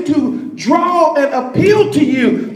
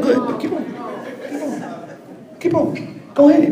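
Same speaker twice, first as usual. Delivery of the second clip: fading dismissal; 5 dB quieter, much faster than usual. Acted. Fading send-off.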